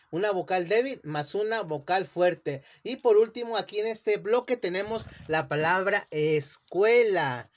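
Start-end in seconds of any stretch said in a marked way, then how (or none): none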